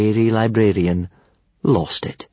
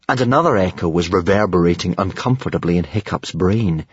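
ordinary delivery

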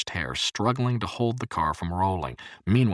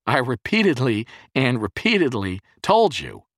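raised, higher-pitched reproach; furious yell; 6 semitones higher, 7 dB louder than usual. A narrator's reading. Jerry reminding the boy of his warnings, angry yell. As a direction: drive each word home angrily. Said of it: "he yelled at the boy in fury".

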